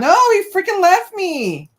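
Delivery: high, loud frustrated yell; loud sharp voice. Real, not acted. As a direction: shout it out in exasperation. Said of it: "Why are you yelling?".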